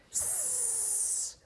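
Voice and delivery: long unvoiced hiss; snake hiss. Boys showing their mum the hiss. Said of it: "We're going".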